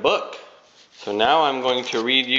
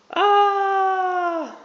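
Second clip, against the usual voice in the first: high startled bear cry. Acted. Bear wakes roaring.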